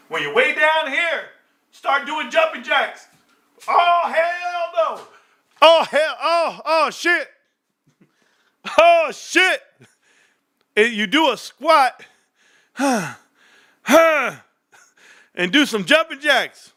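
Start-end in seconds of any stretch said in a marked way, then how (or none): 3.7-9.6 high, strained cries of effort; straining. Real, not acted. Struggling mid jumping jacks. 10.8-16.7 out of breath. Real, not acted. Talking while exercising.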